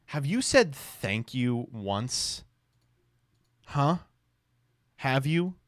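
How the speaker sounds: slow, drawn-out mock demand; lightly hammed-up interrogation, pressing for an answer; mock scolding. Vance demanding thanks in a meme, mock scolding.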